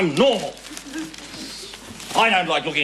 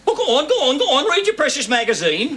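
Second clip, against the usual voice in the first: high, laughing sing-song parody of her; mocking mimicry; 9 semitones higher than usual. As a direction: throw her words back at her, mocking. Mocks wife's words back at her.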